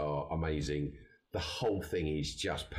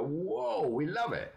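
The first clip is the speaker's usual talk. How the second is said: high, excited whoa; mock English delight; 12 semitones higher, much slower than usual. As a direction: gush it like a fan. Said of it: "us English guys go".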